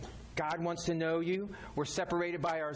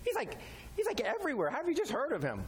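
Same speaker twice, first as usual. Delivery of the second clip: higher, incredulous wow at the idea; amazed disbelief; 6 semitones higher, faster than usual. Retold or imitated. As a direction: act amazed anyone has only heard of Him. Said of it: "I'm like".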